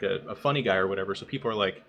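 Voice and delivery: ordinary talk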